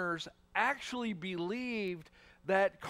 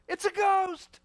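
high, loud mock fright; hammed-up panicked cry; 8 dB louder, slower than usual. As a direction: play their panic for laughs. Retelling the disciples' cry.

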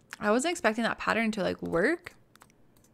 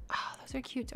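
unvoiced, much softer whisper; 3 semitones higher, 10 dB quieter than usual. Real, not acted. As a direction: breathe it out quietly. Soft aside while browsing.

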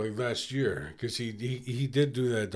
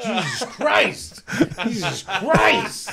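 higher, slow, drawn-out disbelief; aghast exclamation; 7 semitones higher, 4 dB louder, much slower than usual. Real, not acted. Host reacts to a very dark Thanksgiving joke, aghast. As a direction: stretch it out in appalled amazement.

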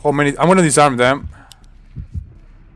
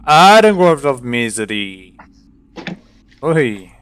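ordinary talk

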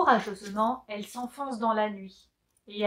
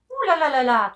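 impressed; 3 semitones higher, 8 dB louder than usual. Acted. Impressed exclamation.

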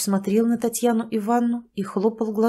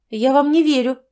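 louder, higher stubborn refusal; defiant; 5 semitones higher, 6 dB louder than usual. Acted. Tatyana to the doctor, loud and defiant. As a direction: declare it flatly.

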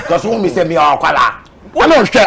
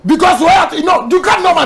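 indignant shout; 7 semitones higher than usual. Real, not acted. Friend challenges a doubter about his bank account, indignant shout. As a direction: demand an answer, offended.

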